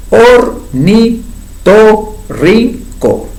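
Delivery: Ornitorrinco broken into five slow syllables, or-ni-to-rrin-co; very slow syllabified. Shown as a demonstration. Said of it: "Cinco sílabas". (Ornitorrinco silabeado muy despacio)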